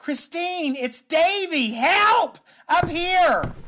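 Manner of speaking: very high-pitched yelling for help; desperate scream. Acted. Tied-up man calls Christine for help, high desperate yell. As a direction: keep screaming up to someone outside. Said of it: "and I scream".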